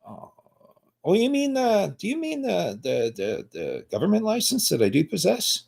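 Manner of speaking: very high and soft, mock innocent; feigned realization. To the cop about the government license, mock innocence. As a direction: act like it only just dawned on you.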